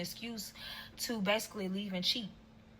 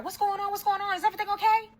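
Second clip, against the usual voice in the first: mimicking his mother's high, louder alarm; the mom squeals her questions, pitched way up; hostile mom; 12 semitones higher, 5 dB louder, faster than usual. Boyfriend's mother on speakerphone, voiced high and alarmed. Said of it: "she's very hostile".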